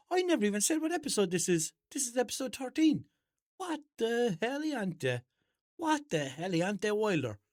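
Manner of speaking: high, loud disbelief; astonished outburst. Real, not acted. Host realising he never gave the episode number, astonished. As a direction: burst out in shrill-pitched, real surprise.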